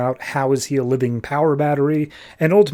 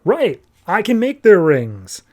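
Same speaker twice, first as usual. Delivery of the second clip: higher, slow retold eureka; dawning realization; 6 semitones higher, 4 dB louder, slower than usual. He works out his own power, dawning realization. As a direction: act out the penny dropping, pitched up. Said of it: "little light bulb goes off".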